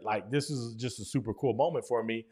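normal talking voice

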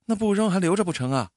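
quick, high-pitched puzzlement; puzzled question. A narrator's reading. Wife questioning keeping broken cosmetics, high puzzled voice. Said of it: "老婆疑惑地问".

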